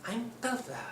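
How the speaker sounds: high, far-off puppet voice; high cartoon puppet voice; puppet character voice. Shown as a demonstration. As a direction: pitch way up into a little puppet.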